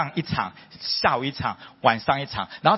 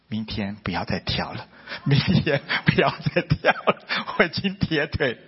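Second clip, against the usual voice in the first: put-on tired old father. A son lightly imitating his tired father begging not to dance, worn out. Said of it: "然后到晚上又说".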